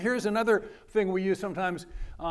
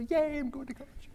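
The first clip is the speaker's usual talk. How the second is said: high, giddy cheer; high-pitched mock cheer of a new student; mock gleeful student; 9 semitones higher, 5 dB quieter than usual. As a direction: squeal it like a thrilled teen. Student cheering about college.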